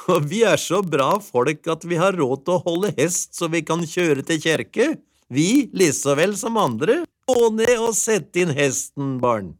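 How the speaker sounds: high, loud, proud housewife; delighted wife. A narrator's reading. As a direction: chatter proudly, then call out to the children.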